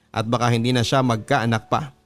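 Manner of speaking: normal talking voice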